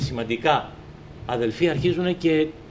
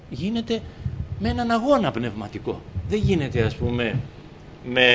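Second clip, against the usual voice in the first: ordinary talk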